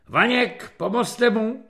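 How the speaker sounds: tense captain's prompt; hissed order. A narrator's reading. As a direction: hiss it under pressure. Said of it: "sykl kapitán na rotného".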